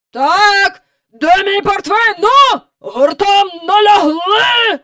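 high, loud sea-dog bellow; booming admiral. A narrator's reading. The admiral bellowing orders while afloat. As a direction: roar orders like an old admiral on deck. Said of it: "могутній, загартований у морі голос".

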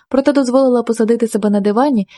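plain reading voice

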